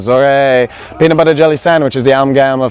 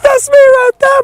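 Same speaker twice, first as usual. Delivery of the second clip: much higher, laughing through the words; much slower than usual. Acted. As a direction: laugh it out, nearly crying. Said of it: "point and laugh and say".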